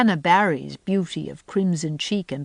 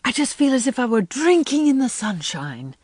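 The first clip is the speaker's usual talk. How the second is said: rapturous; 7 semitones higher than usual. A narrator's reading. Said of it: "breathed Anne".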